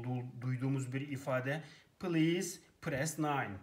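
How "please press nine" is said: very slow, evenly spaced automated-menu read of Please press nine; slow call centre recording. Shown as a demonstration. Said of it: "çağrı merkezlerini ararken bol bol duyduğumuz".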